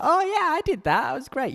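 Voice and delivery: high-pitched mock-enthusiastic tourist; mocking gushing tourist. Mocking slum tour customers.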